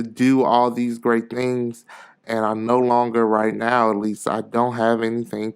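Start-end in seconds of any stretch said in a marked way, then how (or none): none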